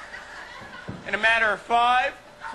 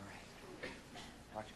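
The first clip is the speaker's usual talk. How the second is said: ordinary talk, nothing marked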